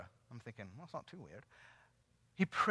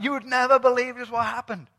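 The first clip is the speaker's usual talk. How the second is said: loud, higher-pitched rush of news; retold excitement; 5 semitones higher, 7 dB louder than usual. Richard telling his wife on returning to church, excited. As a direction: burst out with news you can't hold in. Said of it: "It was just so exciting".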